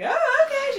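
high, loud mock scream. Podcaster imitating a castmate's screamed reaction, screaming. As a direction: imitate her shrieking reaction, laughing. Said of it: "She's, like, screaming".

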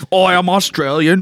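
high, hammed-up Australian accent; mock Australian accent. Joking about doing impressions, mock Australian accent. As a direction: put on a silly Australian voice. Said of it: "when I do the Australian one".

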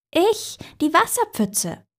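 high little puddle voice; small, high-pitched creature; tiny little voice. A narrator's reading. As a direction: pipe up high to introduce yourself. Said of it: "von unten ein Stimmchen".

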